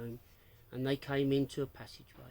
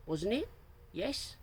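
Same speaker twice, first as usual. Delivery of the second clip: exaggerated upper-class lilt; hammed-up posh retort; 8 semitones higher than usual. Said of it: "retorted Sophie in an exaggerated posh voice".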